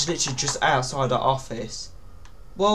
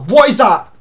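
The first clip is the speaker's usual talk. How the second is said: sudden loud, higher cry of alarm; alarmed shout; 5 semitones higher, 13 dB louder than usual. Real, not acted. Player spots something in a horror game, alarmed shout. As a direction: blurt it out in real panic.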